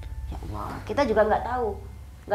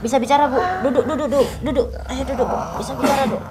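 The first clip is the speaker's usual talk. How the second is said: loud urging; 4 semitones higher, 5 dB louder than usual. Real, not acted. Urging the woman to sit, talk.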